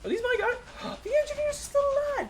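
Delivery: high, loud gasp of delight; shocked and thrilled they survived; surprised. Real, not acted. Surprised his engineers survived.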